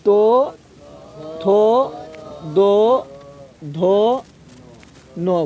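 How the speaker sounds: तो, थो, दो, धो, नो each said alone with a pause after it; slow syllable drill. Shown as a demonstration. Teacher reciting the dental row, syllable by syllable, for the class. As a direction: sound out the dental row one by one. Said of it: "देख, इस दंत सो बोल".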